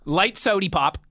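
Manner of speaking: normal talking voice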